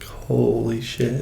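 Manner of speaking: slow, hushed, drawn-out amazement; hushed disbelief. Retold or imitated. Stunned brother reading alarming hospital texts, hushed disbelief. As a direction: stretch it out softly, in awe.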